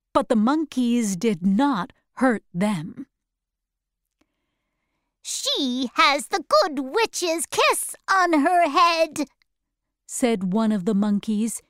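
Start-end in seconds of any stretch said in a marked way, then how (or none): 5.2-9.3 high, odd-timbred creature voice; high monkey voice. Acted. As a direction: squeak it out like a flying monkey. Flying monkey speaks.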